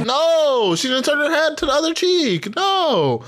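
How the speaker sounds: real disbelief, voice leaping up; shocked protest at the video; excited disbelief. Real, not acted. Disbelief watching the kiss replay.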